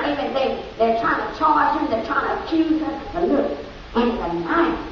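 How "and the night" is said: normal talking voice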